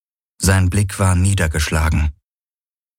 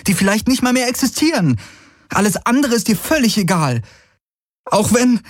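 very high, agitated and fast in bursts; upset outburst; 13 semitones higher than usual. Acted. She confronts her companion about his obsession, upset outburst. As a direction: pour out the reproach until breath runs out. Kirai hält Galen aufgebracht alles vor.

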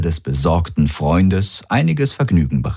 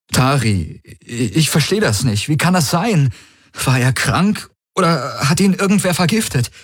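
high-pitched, distressed questioning; feigned bewilderment; 9 semitones higher than usual. A narrator's reading. Valyr questions Tari as the worried friend, in feigned bewilderment. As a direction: grope for an explanation, voice climbing.

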